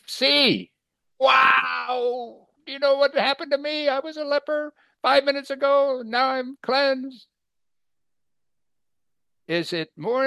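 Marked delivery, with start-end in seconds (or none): 0.1-0.7 high, excited shouting, far above his own voice; ecstatic healed leper. Retold or imitated. Healed leper bursting with joy. 1.2-2.5 high cry of joy, shouted; leper's joyful shout; ecstatic wow. Retold or imitated. Joyful exclamation. 2.6-7.3 high, excited shouting, far above his own voice; ecstatic healed leper. Retold or imitated. Healed leper bursting with joy.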